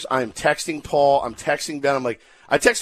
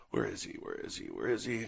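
nervous mutter; 17 dB quieter than usual. Retold or imitated. Imitating panicked host muttering.

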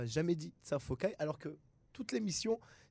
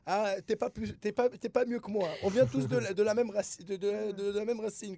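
higher and louder indignant comeback; mock retort; 5 semitones higher, 7 dB louder than usual. Man acting out his retort to Israelis, loud and indignant. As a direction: throw it back at them, fired up.

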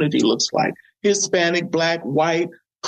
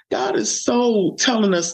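ordinary delivery

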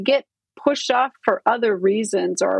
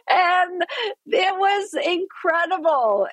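laughing; 8 semitones higher, slower than usual. Real, not acted. Laughing at her own story.

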